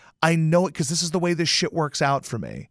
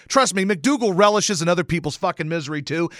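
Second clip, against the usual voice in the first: ordinary talk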